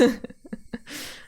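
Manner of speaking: high, breathless giggle; laughter. Real, not acted. Reader explaining the voices, real giggling. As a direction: dissolve into giggles after explaining.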